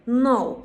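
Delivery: "Know" said as "now", loud; silent k. Shown as a demonstration. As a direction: drop the K in "Know". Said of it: "K'yi yutarız". ("Know" K'siz okunur)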